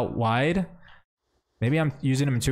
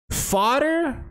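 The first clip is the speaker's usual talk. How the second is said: pitched way up, stretched and falling; high exaggerated ponder; 12 semitones higher than usual. Real, not acted. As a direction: toss the word up high like a question to yourself. Weighing pack options aloud.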